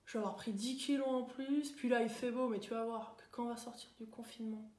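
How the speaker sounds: mope quietly through a list of worries; gloomy grumbling. Acted. Thinking of uncool things in lockdown, gloomy. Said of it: "penser à des trucs pas cool".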